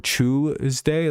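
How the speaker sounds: "Tuesday" drawn out with a "choo" start; British pronunciation. Shown as a demonstration. Streamer explaining the British word, "Tuesday" said as CHOOZ-day. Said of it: "like, spelled C H U E S D".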